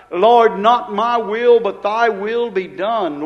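slow fervent prayer. Acted. Acted prayer of surrender.

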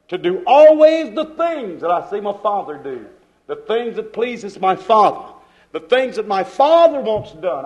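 ordinary delivery

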